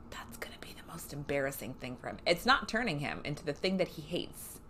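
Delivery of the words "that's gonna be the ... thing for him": whispered, gloating aside; whispered gloat about the beaten boss. Real, not acted. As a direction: breathe it out, a smug secret.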